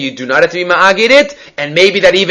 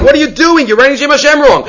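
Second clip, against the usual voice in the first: retold scream; 8 semitones higher than usual. Dreamer screaming at the scribe for writing wrong, mock yell. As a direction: scold in a raised, protesting pitch. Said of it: "I screamed at him".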